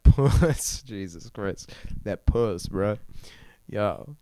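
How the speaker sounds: usual speaking voice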